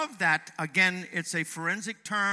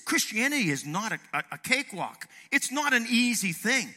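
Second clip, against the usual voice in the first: usual speaking voice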